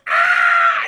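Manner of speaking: high, loud cartoonish scream; mock scream. Toad kicked into the ray, hammed-up high scream. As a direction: scream out one long high "ah" in mock agony.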